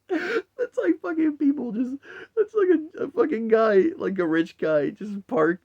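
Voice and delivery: squeezing words out between giggles; laughing. Real, not acted. Describing the rich guy's house crushed, laughing hard. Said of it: "just laughing at that".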